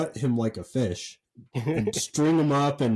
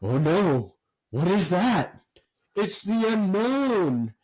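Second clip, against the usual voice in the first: hammed-up stage alarm, climbing high and drawn out; mock-scared children's entertainer announcing a villain; [name]; 5 semitones higher, slower than usual. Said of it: "you hear [name] saying".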